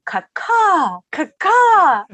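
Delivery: high, loud calls, second syllable stretched; drunken screaming. Retold or imitated. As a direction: mimic drunks hollering at a bartender. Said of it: "they kept screaming".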